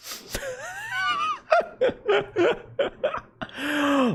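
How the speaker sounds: shrieking laugh bursting out. Real, not acted. Laughs at own joke.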